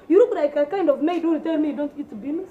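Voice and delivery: ordinary delivery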